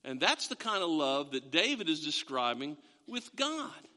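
ordinary talk, nothing marked

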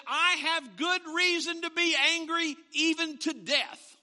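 high, loud furious outburst; angry Jonah. Retold or imitated. Jonah shouting back at God, high and loud. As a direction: rage at God over the plant. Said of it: "And he said".

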